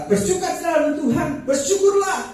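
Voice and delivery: loud, high-pitched pulpit cry; re-enacted rousing sermon shout; preaching exhortation. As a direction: proclaim it to the whole congregation at full voice. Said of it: "kalau saya khotbah, saya berkata".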